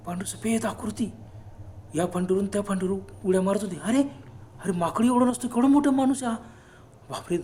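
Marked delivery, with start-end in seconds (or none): 3.8-7.4 alarmed exclamation. Acted.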